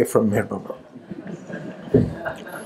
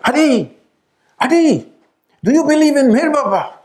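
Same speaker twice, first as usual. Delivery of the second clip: high, louder call down a staircase; 12 semitones higher, 4 dB louder than usual. Retold or imitated. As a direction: call to him from the top of the stairs. Calling to fiancé down the stairs.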